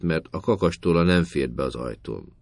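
usual speaking voice

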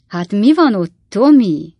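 very friendly. A narrator's reading. Klappinsné pumps Tommy about the pantry, very friendly. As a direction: lean on the boy with exaggerated friendliness. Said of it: "nagyon barátságosan".

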